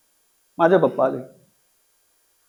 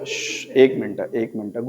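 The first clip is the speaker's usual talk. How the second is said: soft, low shushing; calm everyone down quietly; hushing; 8 semitones lower, 6 dB quieter than usual. Retold or imitated. Son hushing the panicking family, soft and low.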